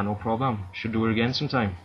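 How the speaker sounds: ordinary talk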